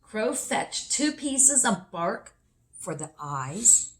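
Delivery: usual speaking voice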